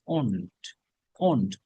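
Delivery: "won't" as a low, falling "ohnt"; pronunciation of won't. Shown as a demonstration. Teacher drilling the class on won't, careful falling pronunciation. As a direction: repeat "won't" as one syllable, "ohnt", dropping in pitch. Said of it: "উচ্চারণ এখানে আছে".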